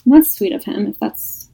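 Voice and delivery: ordinary talk, nothing marked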